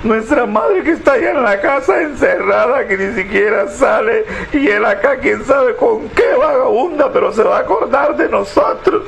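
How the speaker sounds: put-on high indignant girl's voice; a scandalised daughter defending her mother; outraged daughter. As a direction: daughter ranting at her father's cheating, pitched high.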